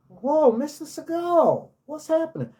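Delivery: high excited hello to a star; overexcited fan greeting. Retold or imitated. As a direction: squeal it like a starstruck fan. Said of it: "we're not supposed to be overly enthusiastic".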